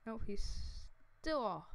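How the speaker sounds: ordinary talk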